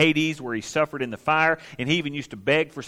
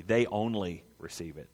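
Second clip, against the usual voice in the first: usual speaking voice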